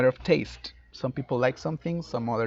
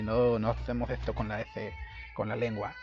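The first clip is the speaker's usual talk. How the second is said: imitated Castilian lisp on the s; Spain Spanish accent; 3 semitones lower, 6 dB quieter than usual. Shown as a demonstration. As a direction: mimic a Spaniard's th-like s, tongue forward. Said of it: "con los españoles y los mexicanos".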